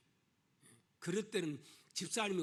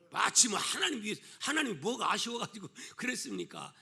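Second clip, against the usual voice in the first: ordinary talk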